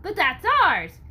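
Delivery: loud, high indignant shout; indignant outcry. Acted. Angry townsman protests the stolen powder, loud. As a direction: protest hotly.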